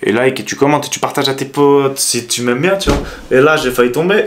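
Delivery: ordinary talk, nothing marked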